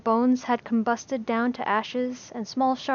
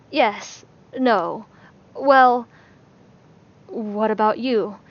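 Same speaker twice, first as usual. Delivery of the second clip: flustered stammer; 5 dB louder, much slower than usual. A narrator's reading. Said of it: "he stammered".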